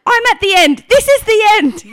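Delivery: high, very loud acted wail; desperate declaration. Mother at transition announcing her limit, loud dramatic cry. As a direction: cry out as if all is lost.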